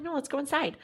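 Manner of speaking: high, soft imitated friend; coaxing friend. Friend urging the storyteller to come inside, retold high and soft.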